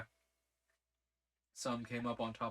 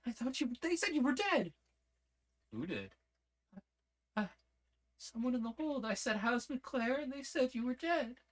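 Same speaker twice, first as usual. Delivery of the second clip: much higher, breathless disbelief; a stunned young woman blurting it out; shaken young woman; 12 semitones higher than usual. Acted. Lizzie telling McClare she heard he was dead, much higher and stunned.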